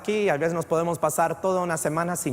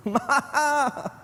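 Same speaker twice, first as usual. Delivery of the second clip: high, drawn-out comic wail; mock cry for mama; 9 semitones higher than usual. Grown man calls mom over a hurt finger, comic wail. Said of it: "es lo que voy a gritar".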